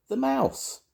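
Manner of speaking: extremely high squeaky voice; mouse squeak. A narrator's reading. Sam telling Grandma who he is, squeaky. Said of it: "squeaked Sam Squeak".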